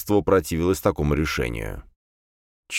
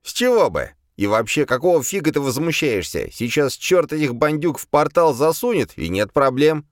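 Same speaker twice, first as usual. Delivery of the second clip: high, light demon voice, unbothered; спокойный демон; 9 semitones higher than usual. A narrator's reading. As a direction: voice the demon as if nothing is wrong. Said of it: "совершенно спокойно ответил демон". (демон, совершенно спокойно)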